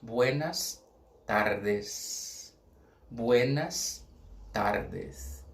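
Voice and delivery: buenas tardes, tardes falling low; slow repeat-after-me. Shown as a demonstration. Teacher has the class repeat buenas tardes. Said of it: "Vamos repetir comigo pra ... aprender a pronúncia".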